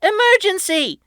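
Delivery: very high, loud urgent cry; alarmed shout. A narrator's reading. Patrick warning his crew of the monster, high and urgent. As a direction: raise the alarm. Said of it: "Patrick shouted".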